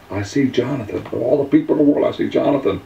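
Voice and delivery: high-pitched, amazed exclamation; excited amazement. Real, not acted. Amazed retelling of finding Jonathan.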